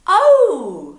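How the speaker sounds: loud, high drawn-out oh; sudden realisation. Acted. Worker finally understands the greeting, surprised realisation. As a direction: let the penny drop in a big high oh.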